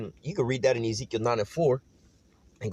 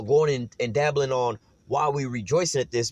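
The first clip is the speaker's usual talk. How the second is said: ordinary talk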